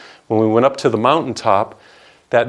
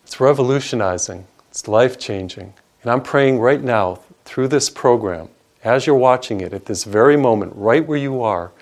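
ordinary delivery

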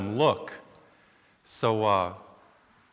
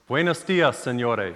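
Spanish greeting; 4 dB louder, slower than usual. Retold or imitated. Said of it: "the first thing he said was".

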